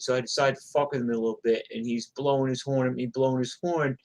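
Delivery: ordinary delivery, nothing marked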